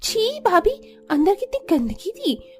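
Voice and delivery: high, disgusted cry. Acted. Sister-in-law disgusted after seeing the factory, high pitched. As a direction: recoil from the filth.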